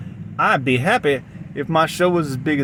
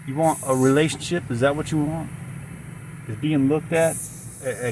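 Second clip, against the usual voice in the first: normal talking voice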